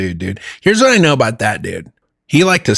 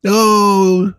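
high, taunting fake lament; mock someone's tears in a raised voice; high-pitched, exaggerated boo-hoo; mock crying; 7 semitones higher, much slower than usual. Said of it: "Now I'm gonna watch you cry".

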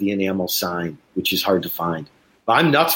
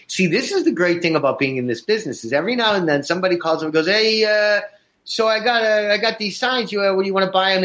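higher, fast, casual seller voice, lightly put on; a hint of an eager stranger on the phone; imitating the caller; 8 semitones higher, faster than usual. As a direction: play the chatty guy calling about signs. Quoting the sign seller's phone call.

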